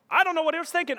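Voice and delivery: high-pitched mock tiger owner; Tiger King owner. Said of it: "Tiger King, anyone?".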